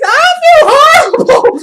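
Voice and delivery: high, loud mock wail; retold wail. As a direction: wail the apology through laughter. Acting out friend's apology.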